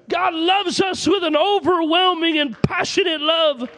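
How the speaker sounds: usual speaking voice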